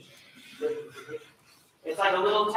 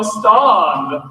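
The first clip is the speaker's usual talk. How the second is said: demo stand; 13 dB louder than usual. Shown as a demonstration. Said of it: "everybody go".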